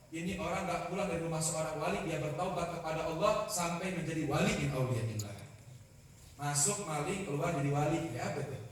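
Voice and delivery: normal talking voice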